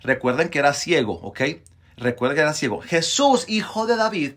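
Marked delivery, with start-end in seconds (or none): 2.8-4.3 pleading call, high then easing down; mild narrated cry for mercy. A narrator's reading. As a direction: call out high at first, then let it settle. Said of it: "comenzó a gritar".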